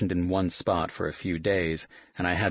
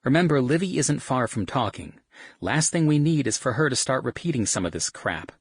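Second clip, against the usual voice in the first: higher, louder warning mother; scolding woman; 7 semitones higher, 4 dB louder than usual. Acted. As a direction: warn them like a mom. Kylie warns about the baby.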